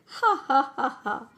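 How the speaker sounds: mock "ha ha" starting high and dropping; staged helpless laugh; acted laughter. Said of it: "I can't help laughing".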